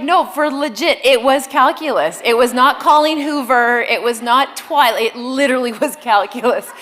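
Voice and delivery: loud, emphatic mock insistence; insistent teen. Mother imitating her daughter insisting to the class, loud and laughing. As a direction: insist it really was calculus, laughing. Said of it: "she's like".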